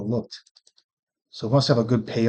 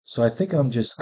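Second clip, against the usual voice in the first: ordinary talk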